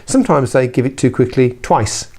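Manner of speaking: ordinary delivery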